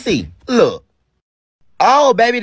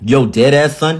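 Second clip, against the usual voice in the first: put-on tough New York street accent; 5 semitones lower, 5 dB louder than usual. Robber testing a New York accent for his partner. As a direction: say it like a hard New York guy. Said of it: "Say it in a New York accent".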